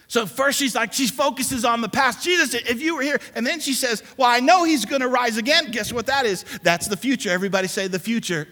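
high, loud sermon delivery driving each line home; rousing preacher voice; full-force preaching. Acted. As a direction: preach it hard to the whole congregation.

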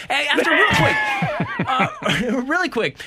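high laughing call-out. Real, not acted. Laughing interrupting with complaint.

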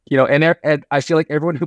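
ordinary talk

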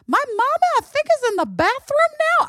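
put-on high, chatty little kid voice; little boy voice. Nephew tells his aunt what he's up to, in a high kid voice. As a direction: play a five-year-old wandering the house. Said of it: "he's like".

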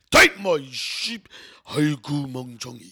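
loud, slow cussing Korean ajusshi; older Korean dad. Acted. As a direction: drag out the scolding like a fed-up older Korean man. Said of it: "I know your dad is like".

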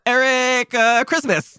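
high, hesitant fake name; stall, then grab a surname; improvised alias. Fugitive pressed for a surname, high stalling invention. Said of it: "somebody asks you your name".

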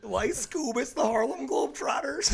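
high-pitched put-on cartoon voice; cartoon sidekick. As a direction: exclaim it like an amazed cartoon character. Answers with a cartoon impression.

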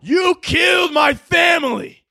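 slow, high-pitched hammed-up scream; melodramatic shout. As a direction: bellow it like an overcommitted voice actor. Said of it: "I would be like".

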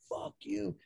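a sudden squeaky high jeer at the coach; mocking high-pitched curse. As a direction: fling it up high and cheeky. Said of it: "He was like".

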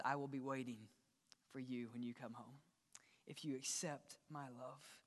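tender God voice. Acted. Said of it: "God says".

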